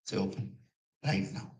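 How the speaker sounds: soft, low urging; hushed coaxing. Retold or imitated. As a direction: coax him gently in a hush. Boss coaxing softly at night.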